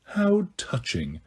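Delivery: slow, soft sarcasm; mock touched. A narrator's reading. Snape mocking Sirius's threat, quiet sarcasm. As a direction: drip sarcasm, slowly and softly.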